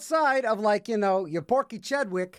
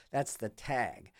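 "That's the tag" dropped much softer and slow; softer, slow repeat; 7 semitones lower, 9 dB quieter, slower than usual. Real, not acted. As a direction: let it fade to a soft, slow aside.

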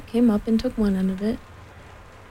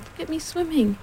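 put-on high, proud child's squeak; 6 semitones higher than usual. A narrator's reading. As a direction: show off in a small high voice. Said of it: "squeaked Roo".

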